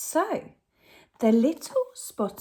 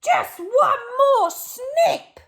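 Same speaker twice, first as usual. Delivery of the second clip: much higher, slow, greedy robber; robber Len; 7 dB louder, slower than usual. Acted. Greedy Len insists on one last snip, high and slow. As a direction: drag it out like a stubborn crook. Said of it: "But Len replied".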